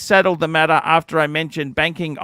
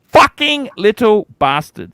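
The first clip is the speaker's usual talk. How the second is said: angry curse; 4 semitones higher, 5 dB louder than usual. Retold or imitated. Cursing the call centre worker.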